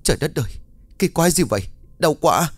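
lightly acted yell after an electric shock; pained cry. A narrator's reading. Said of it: "sau tiếng hét của ông Khóm". (kêu la đau đớn)

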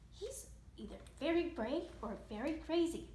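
plain reading voice

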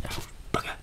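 soft whispered oath; muttered curse. Real, not acted. Hits the wrong key, swears under his breath. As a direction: swear quietly to yourself. Mistypes on tiny keyboard.

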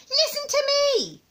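very high, loud granny order; high-pitched bossy old woman; commanding grandma. Acted. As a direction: demand attention imperiously. Grandma demands the boy's attention.

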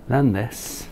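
ordinary delivery

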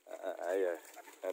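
ordinary delivery

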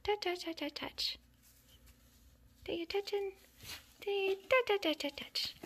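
high, bouncy string of touches; playful patter. Real, not acted. Getting kittens used to touches, high playful patter.

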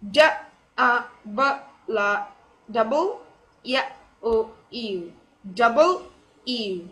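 "double U" split into sounds, then two parts; teacher's piece-by-piece "double U"; divided pronunciation. Shown as a demonstration. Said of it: "better to pronounce it by dividing".

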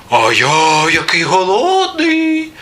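slow, rising squeaky wail; hungry little mouse. A narrator's reading. Second mouse claims hunger too, squeaky voice. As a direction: stretch it upward into a mouse squeak. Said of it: "каже верть".

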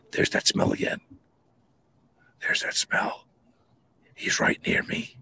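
hushed, whispered dread; retold fearful whisper. Camper alone in his tent senses the creature, whispering. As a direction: whisper it as if something is close by.

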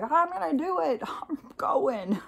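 high, excited mock dog voice breaking into laughs; eager old dog. Old lab still wanting to run, eager and amused.

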